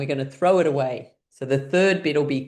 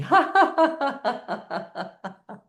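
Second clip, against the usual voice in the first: high burst of laughter, dying away; drinking laugh; 10 semitones higher, much slower than usual. Shown as a demonstration. Drinking it up while laughing, high fading laughter. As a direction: start high and let the laugh fade off. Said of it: "drink it up while laughing".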